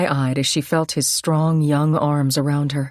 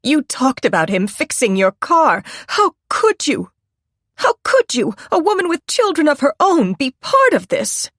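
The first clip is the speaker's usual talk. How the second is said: very high, crying outrage; a mother tearfully accusing the suspect; tearful accusation; 11 semitones higher, 4 dB louder than usual. A narrator's reading. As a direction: break into anguished accusing cries. Angry disgusted mother accusing.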